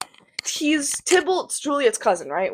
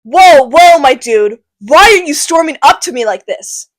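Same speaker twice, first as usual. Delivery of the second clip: loud Capulet; 2 semitones higher, 8 dB louder than usual. Acted. Capulet stopping Tybalt storming up, loud and startled. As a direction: call out to calm a charging nephew.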